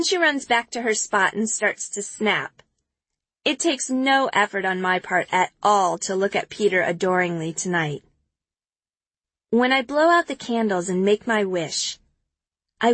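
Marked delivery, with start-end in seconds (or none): none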